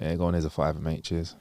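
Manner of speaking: low, soft grudging handover of a fiver; grudging tipper. Retold or imitated. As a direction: mutter it like a reluctant tipper. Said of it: "I'm like".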